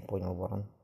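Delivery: ordinary delivery, nothing marked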